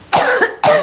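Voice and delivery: sharp choking cough. Real, not acted. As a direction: cough on a stray hair. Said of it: "I'm choking on something here".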